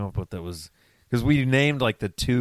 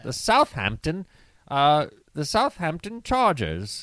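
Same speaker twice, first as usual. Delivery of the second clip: higher, slow mock British accent; 6 semitones higher than usual. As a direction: play up a British announcer. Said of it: "fun to say in the British accent".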